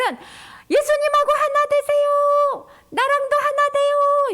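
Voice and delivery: 높고 크게 느리게 외치는 초대; 모두를 불러 모으듯 길게; calling out. Shown as a demonstration. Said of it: "이렇게 하는 거야". (높고 크게 느리게 외치기)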